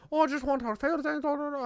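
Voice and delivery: rushed mock mumbling, barely intelligible; mumbling customer impression. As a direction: imitate a mumbler, words dissolving into gibberish. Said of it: "he's mumbling, bro".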